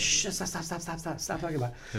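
hushed, rapid-fire stops; mock urgent hushing. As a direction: shush them before anyone hears. Retold frantic hushing of passengers.

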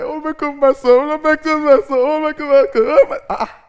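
high, loud mock wailing for her son; wailing mother. Mother mock-wailing over her son in distress. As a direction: ham up a distraught mother crying oh my God.

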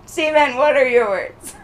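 ordinary delivery

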